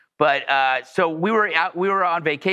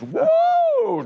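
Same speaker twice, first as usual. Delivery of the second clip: high woo. Retold or imitated. Escaping the scene.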